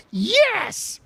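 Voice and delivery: high-pitched mimicked yelp; squeaky put-on voice; tipsy woman impression. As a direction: imitate her eager answer way up high. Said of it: "she was talking a bit like this".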